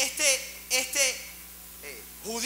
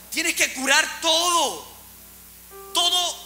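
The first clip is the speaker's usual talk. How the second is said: higher, slow insistent order; firm instruction; 8 semitones higher, 4 dB louder, slower than usual. Acted. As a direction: lay the charge on the innkeeper clearly. Samaritan instructs the innkeeper.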